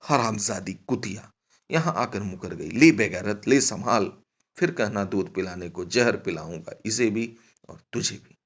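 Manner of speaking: loud, cursing outburst at a companion; acted furious scolding; angry yelling. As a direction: hurl abuse, punching the insults loudly. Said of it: "चिल्लाकर बोली".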